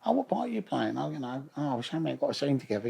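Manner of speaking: breezy showbiz greeting, lightly voiced; hint of a theatrical old cockney dame; Dot Cotton voice. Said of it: "exactly like she's in the show".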